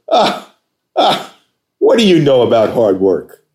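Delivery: high, loud scoff then a sneering question; mock the caller's claim to work hard; scoffing challenge. Boss on the phone scoffing at an applicant, high and loud. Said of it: "Just like that".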